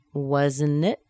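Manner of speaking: "Wasn't it" rising steeply at the end; rising intonation. Shown as a demonstration. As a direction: lift "it" as a question. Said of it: "Going up in intonation".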